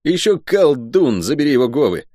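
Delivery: high, irritated soldier voice; high-pitched, cross guardsman; angry curse. A narrator's reading. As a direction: spit it out in annoyance. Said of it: "Зло сплюнул солдат себе под ноги".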